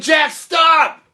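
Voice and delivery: high, very loud call; urgent shout. Acted. King running out after Jack, urgent high shout. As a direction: holler after someone walking away.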